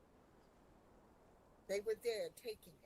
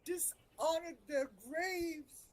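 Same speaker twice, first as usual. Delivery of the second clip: grieving; 7 semitones higher, 4 dB louder than usual. Acted. Grieving lost friends, still emotional.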